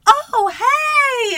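very high, loud, drawn-out hello; gushing greeting. Acted. Date turning round to greet her, hammed-up excited shout. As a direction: shout it in an over-excited squeal. Acts out date's surprised greeting.

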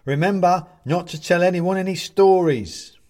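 high, loud little figure calling; Bobs calling. A narrator's reading. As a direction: shout it after him, pitched high. Bobs calls down after Benedict.

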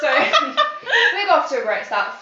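high, slow laughing. Real, not acted. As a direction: laugh through it. Laughs at own mistake.

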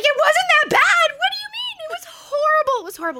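high, loud distraught cry; distraught wail. Retold or imitated. Sobbing to her husband in the green room, high wail. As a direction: wail it, begging not to be seen.

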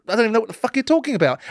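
usual speaking voice

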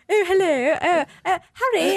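put-on a light, airy posh British girl; lightly imitated posh English voice; posh Hermione. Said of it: "They sound the same".

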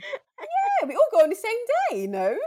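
high, loud, thrilled yeah; excited squeal. Real, not acted. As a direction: gush it out happily. Excited agreement about gifts.

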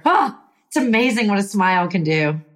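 ordinary talk, nothing marked

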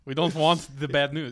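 put-on blunt comic Russian, accent laid on thick; mock Russian accent. Friend joking along as a Russian, loud mock Russian accent.

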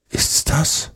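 high, breathy whisper; whispered question. A narrator's reading. Tom asks Huck about the snoring, whispering. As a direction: ask it under your breath.

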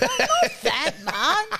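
slow, through laughter; laughing. Real, not acted. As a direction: admit it while laughing. Laughing admission.

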